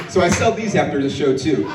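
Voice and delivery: normal talking voice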